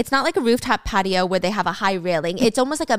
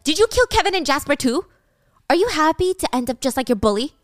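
higher, mildly heated accusation; accusing; 5 semitones higher, 3 dB louder, faster than usual. Retold or imitated. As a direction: confront them with pitched-up questions, in retelling. Accusing confrontation in retold story.

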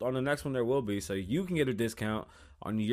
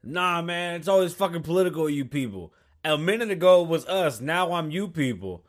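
mock angry; 6 semitones higher, 6 dB louder than usual. Voicing the other guy, accusing.

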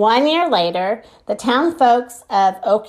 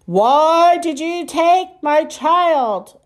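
slow, lightly voiced alien boom; a hint of a giant booming alien; 6 semitones higher, much slower than usual. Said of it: "a deep voice boomed".

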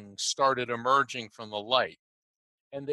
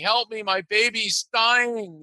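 higher, drawn-out cry for help; imitated desperate plea; 9 semitones higher, 3 dB louder, slower than usual. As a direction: act out a mother calling for help, slowly. Mom cries out for help.

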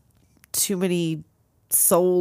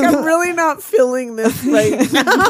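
higher mock-reluctant voice, laughing; laughing quote; 5 semitones higher, 3 dB louder than usual. Co-host voicing the uneasy worker, laughing. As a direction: voice the reluctant worker while giggling.

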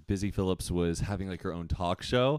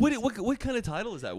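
incredulous; 12 semitones higher, faster than usual. Real, not acted. Teasing about odd title.

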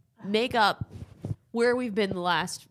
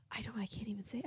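whisper; 14 dB quieter, faster than usual. Real, not acted. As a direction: breathe it out, unable to say more. Unable to announce the death.

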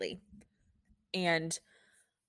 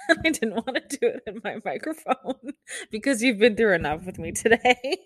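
higher, talking through real laughter; laughing; 5 semitones higher, 4 dB louder than usual. Real, not acted. Nurse joking to listeners about her sniffles, laughing. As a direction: giggle through the words after crying.

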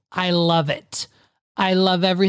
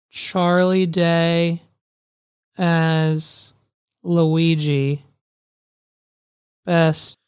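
slow, spaced-out words; halting text-message dictation, long gaps; dictating while typing; 2 semitones lower, much slower than usual. Real, not acted. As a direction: say each chunk as you type it.

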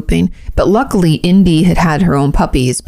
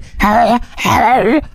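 raised, rasping growl noises; imitated dog growl; 6 semitones higher than usual. Imitating our dog meeting the visiting dog, growling. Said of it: "growls, barks, is like".

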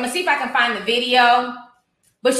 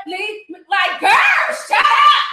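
exasperated; 8 semitones higher, 4 dB louder, much slower than usual. Real, not acted. Exasperated at the clip.

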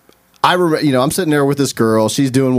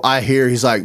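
ordinary talk

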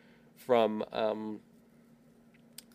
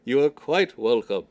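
louder booming alien voice; deep voice; 7 dB louder than usual. Retold or imitated. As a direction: play a giant alien, booming and polite. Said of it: "we hear a deep voice saying".

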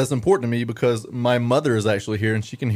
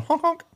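clown horn honk. Acted.

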